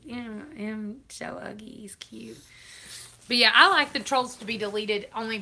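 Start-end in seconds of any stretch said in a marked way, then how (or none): 0.0-2.4 whispered teasing aside; a teasing little whispered dig; playful whisper. Acted. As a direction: whisper it like a secret joke. Teasing aside about the pug.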